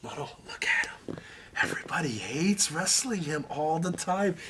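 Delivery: unvoiced soft teasing whisper; whispering to a friend to make him laugh. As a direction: lean in and whisper the joke. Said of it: "whispering in his ear".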